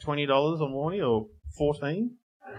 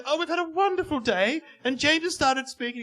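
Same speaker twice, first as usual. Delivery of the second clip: cheery wife; 10 semitones higher than usual. Retold or imitated. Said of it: "she goes".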